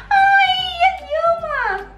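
high squealing at the llamas; delighted squeal. Real, not acted. (gritinho fofo de alegria)

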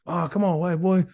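high, wheedling imitation of a neighbour; pestering caller.

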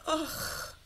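soft, slow, crying endearment; crying softly to her husband; tearful tenderness. Acted. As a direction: weep his name softly. Wife weeps tenderly to husband.

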